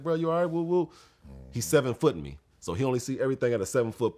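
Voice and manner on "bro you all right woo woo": high worried call. Retold or imitated. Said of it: "I was just like".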